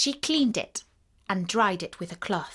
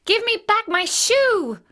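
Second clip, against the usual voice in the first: put-on high, loud little-girl outrage; angry child's outburst, pitched way up; angry demand; 13 semitones higher, 6 dB louder than usual. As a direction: demand it back in a high, indignant voice. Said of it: "grew angry".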